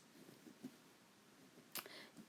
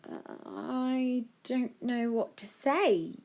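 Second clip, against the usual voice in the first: slow, higher, lost for words; stunned; 8 semitones higher, much slower than usual. A narrator's reading. Joe takes in his new house and staff, stunned. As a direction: draw it out, dumbstruck.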